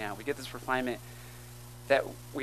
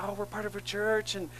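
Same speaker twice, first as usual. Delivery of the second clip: higher-pitched, lightly imitated teenage enthusiasm; eager teens; 7 semitones higher than usual. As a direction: voice keen teens inviting a stranger in, pitched up. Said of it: "And they're like".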